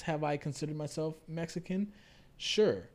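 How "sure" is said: ordinary talk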